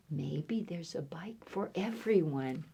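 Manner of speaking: hushed, whispered suggestion; secretive whisper. Acted. Reader hints what the animals think, secretive whisper. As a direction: whisper it as if sharing an idea.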